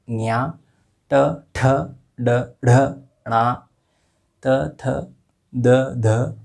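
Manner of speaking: each letter said slowly and distinctly, with pauses; clear letter recitation. Shown as a demonstration. Teacher recites letters for students, slow and deliberate. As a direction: spell out the alphabet for students. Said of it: "उच्चारण सही होना चाहिए".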